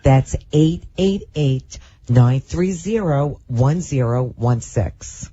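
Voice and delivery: slow it right down with gaps between groups; slow, deliberate. Shown as a demonstration. Co-host repeats the phone number for listeners who found it too fast, slowly. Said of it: "So I'll say it slow once again".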